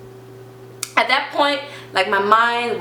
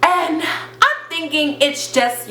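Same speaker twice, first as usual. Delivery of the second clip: usual speaking voice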